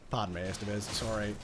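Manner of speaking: ordinary delivery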